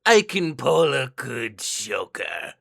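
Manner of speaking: slow sinister clown drawl; Joker voice. Acted. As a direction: purr it like the Joker, slowly. Shows off Joker voice.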